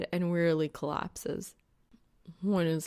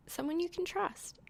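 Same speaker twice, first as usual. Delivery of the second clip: higher and softer, a mourning dove lilt; simple, with a soft lilt; 12 semitones higher, 5 dB quieter than usual. A narrator's reading. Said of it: "she says simply, a mourning dove lilt".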